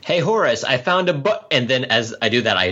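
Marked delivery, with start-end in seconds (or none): 0.0-1.3 higher-pitched in-character call. Acted. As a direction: call up brightly to a companion. Calls to companion in character.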